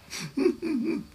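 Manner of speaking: a short high sob sound, mimicked; one quick high mock sob; imitated sob. Pastor mimicking the wife's sob behind the curtain. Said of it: "在那儿哭".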